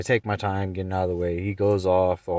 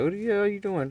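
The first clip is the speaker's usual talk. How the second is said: high, loud retold yell; mock shouting at the guy; high imitated yell at the stranger; yelling at him; 14 semitones higher, 3 dB louder than usual. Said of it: "It's like".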